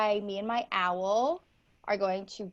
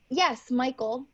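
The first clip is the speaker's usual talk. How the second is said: usual speaking voice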